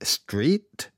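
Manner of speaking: a hint of the officer checking the word; policeman switching to English. A narrator's reading. Policeman correcting himself for the asylum seeker, rising question. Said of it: "korrigiert sich sofort".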